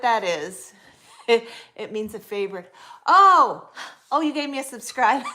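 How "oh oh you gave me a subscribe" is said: high, loud laughing "oh"; delighted burst of laughter at her own mix-up; laughing realization. Real, not acted. Misunderstands a viewer's gift, laughs.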